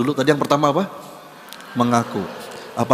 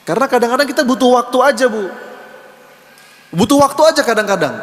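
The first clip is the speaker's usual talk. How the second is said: much higher, louder and fast; urgent emphasis; 12 semitones higher, 6 dB louder, faster than usual. Real, not acted. Preacher urging the mothers, high, loud and quick. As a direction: press the point home excitedly. Preacher stresses needing time.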